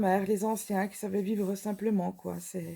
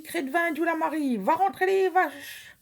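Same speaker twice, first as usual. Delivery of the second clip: raised Franc-Comtois farmyard call; hearty patois oath; 6 semitones higher than usual. Shown as a demonstration. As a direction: belt out the old Franc-Comtois swear like a farmer. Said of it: "si je vous dis".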